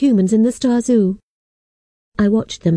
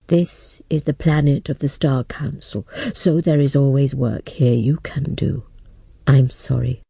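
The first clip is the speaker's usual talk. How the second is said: put-on lower, grave old man voice; a sorry old man breaking bad news; 7 semitones lower than usual. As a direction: lower apologetic elder. Old man speaks again.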